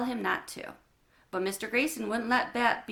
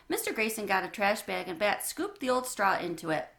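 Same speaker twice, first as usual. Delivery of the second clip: usual speaking voice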